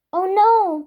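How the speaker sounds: high, loud dismayed cry; exclaiming. A narrator's reading. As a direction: gasp it out in dismay. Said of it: "Kirsty exclaimed".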